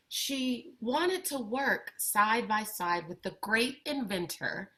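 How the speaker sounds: put-on expressive read-aloud for kids, lively and clear; picture book reading. A narrator's reading. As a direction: bring the story to life for young listeners. Reads picture book to children.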